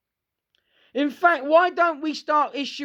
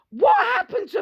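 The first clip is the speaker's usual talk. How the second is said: exasperated; 13 semitones higher, 4 dB louder than usual. Real, not acted. Exasperated rant about people.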